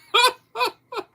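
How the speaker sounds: short shrill giggle; a quick delighted squeal of laughter; high squeaky giggle. Real, not acted. Amused by the topic.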